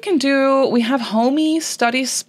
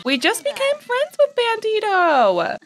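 much higher, thrilled squeal; squealing joy; 12 semitones higher than usual. Real, not acted. Player thrilled at new cat friend, high squealing voice. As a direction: squeal it like a dream came true.

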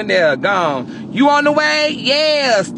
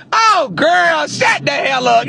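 high, loud teasing yell; loud mock scolding of a friend; playful shout; 8 semitones higher, 5 dB louder, slower than usual. Real, not acted. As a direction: shout her down, laughing at her.

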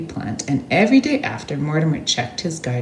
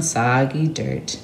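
plain reading voice

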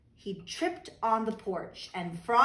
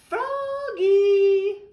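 calling out; 10 semitones higher, 9 dB louder than usual. Acted. Teacher calls out in class.

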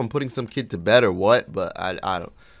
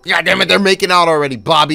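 higher, much louder, frantic complaint; exasperated dad yell; 7 semitones higher, 11 dB louder than usual. Acted. Dad yelling at Bobby for ruining his movie night, exasperated. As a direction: rant at the kid who keeps interrupting the movie.

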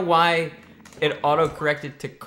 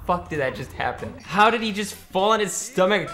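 raised, exasperated disbelief; incredulous outburst; 6 semitones higher, 3 dB louder than usual. Real, not acted. Player protesting a fall in the game, incredulous. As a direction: demand to know how that went wrong. Incredulous after failed trick.

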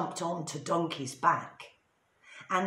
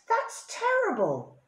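shocked. A narrator's reading. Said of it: "said the dog, the donkey, and the cat".

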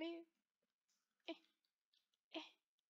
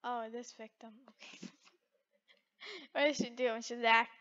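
ordinary talk, nothing marked

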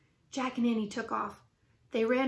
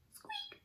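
tiny high squeak, very soft; high, thin mouse squeak; squeaky mouse squeak; 15 dB quieter than usual. Acted.

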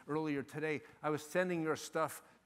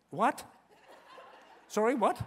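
higher, questioning mock bewilderment; baffled reaction; 5 semitones higher, 5 dB louder than usual. Advisor hearing Chris's jargon, acting baffled. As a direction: play up total incomprehension.

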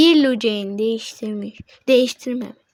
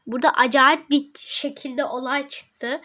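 ordinary talk

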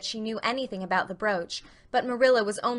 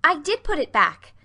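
quick, pert retort, higher and louder; 7 semitones higher, 8 dB louder than usual. A narrator's reading. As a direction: snap back pertly. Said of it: "said Anne quickly, pertly".

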